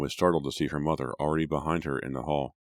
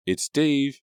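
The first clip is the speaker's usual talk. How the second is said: higher, louder patient call; patient shout; 11 semitones higher, 6 dB louder than usual. A narrator's reading. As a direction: shout up, but kindly. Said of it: "shouted patiently".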